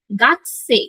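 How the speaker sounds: গাতসে said slowly as gakse; slow gakse with a clipped k; correct pronunciation. Shown as a demonstration. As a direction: model গাতসে as gak-se. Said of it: "উচ্চারণটা কিন্তু হবে গাকসে".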